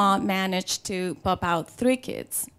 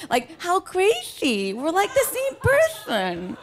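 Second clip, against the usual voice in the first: high, squealy gushing, played for laughs; giddy excitement; 9 semitones higher than usual. Woman gushing over her crush, giddy and hammed-up. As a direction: gush like a smitten girl, pitched way up. Acting smitten over shared tastes.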